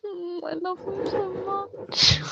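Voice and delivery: high, soft, weepy squeak; mock tearful gushing. Player gushing love for Hugo, mock tearful squeak. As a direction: gush softly as if welling up.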